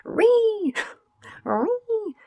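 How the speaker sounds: very high squealing Ree; mocking shriek. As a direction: shriek it like a rude stranger. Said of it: "they turned around and said".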